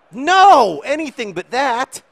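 very high, loud, slowed cry; hammed-up dismay at the miss; anguished outcry. Real, not acted. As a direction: protest in comic despair. Chance goes wrong.

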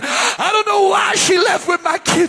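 high, loud revival sermon building to a cry; full-force preaching. Real, not acted.

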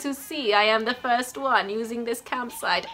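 badly attempted Italian accent; Huck. Camper greeting the islander in a mock Italian accent. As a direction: have a clumsy go at Italian. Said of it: "I'm not good with Italian accents".

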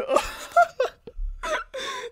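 long squealing laugh; can't stop laughing; high helpless laughter. Real, not acted. Gamer guilty after bow-spamming win, helpless laughter.

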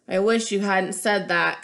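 slow, weary groan; mild groaning complaint. Acted. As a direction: drag the words out in a groan. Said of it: "Camilla groaned".

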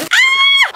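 very high, loud shriek; piercing squeal of delight; excited scream. Real, not acted. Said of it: "I'm so excited".

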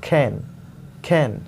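खेन with a soft aspirated k; khen demo. Shown as a demonstration. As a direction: say खेन, k between k and kh. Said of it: "क और ख के बीच वाला sound".

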